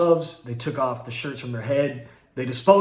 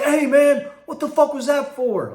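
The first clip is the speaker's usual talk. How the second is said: confused protest; 10 semitones higher, 4 dB louder than usual. Retold or imitated. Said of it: "he seemed confused".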